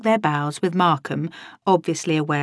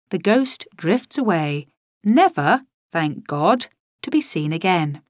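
ordinary delivery, nothing marked

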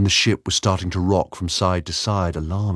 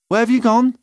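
high, loud call; 6 dB louder than usual. Acted. Layla calling in dark.

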